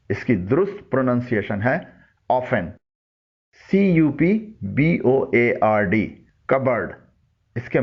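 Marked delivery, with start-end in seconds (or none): 2.3-2.7 often said "OFF-en"; silent T. Shown as a demonstration. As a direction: drop the t in often. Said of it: "ٹی خاموش ہے".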